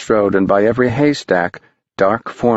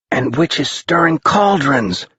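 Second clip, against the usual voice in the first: put-on high, wondering kid; a boy pointing below; higher young voice; excited boy; 6 semitones higher than usual. A narrator's reading.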